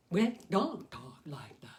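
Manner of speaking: slow whispered, overmouthed words; exaggerated mouth talk. Shown as a demonstration. Puppeteer demonstrates how people don't talk, slow whispered mouthing. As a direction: mime the big jaw moves nearly voiceless.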